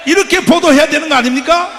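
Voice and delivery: a preacher yelling at the media; furious shout. Real, not acted. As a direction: hurl it at the press. Rally rant at the press.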